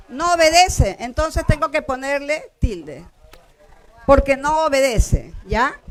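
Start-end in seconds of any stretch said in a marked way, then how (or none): none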